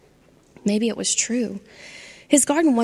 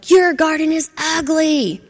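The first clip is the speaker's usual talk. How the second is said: loud, slow, higher yell; 7 semitones higher, 5 dB louder than usual. Acted. Angry Charlie yelling at a gardenia, loud and slow. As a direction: bellow the insult slowly. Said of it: "started yelling".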